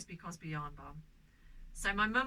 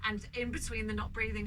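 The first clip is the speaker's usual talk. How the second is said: usual speaking voice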